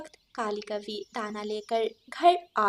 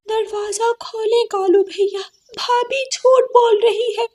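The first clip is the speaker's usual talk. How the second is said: high, loud desperate plea; pleading cry; 12 semitones higher, 8 dB louder than usual. Acted. Locked-out sister begs brother to open, loud and high. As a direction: beg through the locked door. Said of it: "काली बहुत रोती है".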